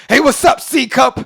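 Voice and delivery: put-on taunting kid. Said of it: "the kids be like".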